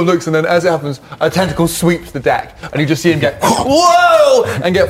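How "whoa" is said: high, loud cry of being knocked flying; mock yell as you're swept off your feet; big high-pitched "whoa"; startled yell. Captain flung across deck by tentacle.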